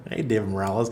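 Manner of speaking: ordinary delivery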